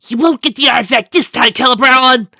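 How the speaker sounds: very high, loud adventure-serial heroine; melodramatic exclamation. A narrator's reading. Mare to her nemesis, melodramatic exclamation. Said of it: "the mare exclaimed, voice rising and falling with each word".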